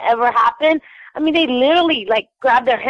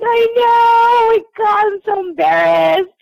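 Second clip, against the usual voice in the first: much higher, louder flustered cry; embarrassed squeal; 10 semitones higher, 3 dB louder than usual. Retold or imitated. Answering her friend, squealing with embarrassment. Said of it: "I'm like".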